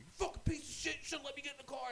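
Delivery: high, loud raging insult; imitating an enraged opponent on voice chat; mock furious yell. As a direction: scream it like a sore loser. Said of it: "And you hear".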